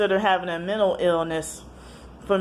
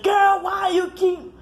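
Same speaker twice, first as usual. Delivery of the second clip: high, slow mocking voice; mocking brother; 13 semitones higher, 4 dB louder, slower than usual. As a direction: mimic the brother on the phone. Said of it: "And he said".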